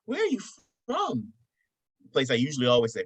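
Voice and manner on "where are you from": high-pitched imitation of a gushing woman; smitten woman. Hostess loving his accent, high gushing imitation. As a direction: gush over it in a put-on female voice. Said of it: "she looks at me, she's like".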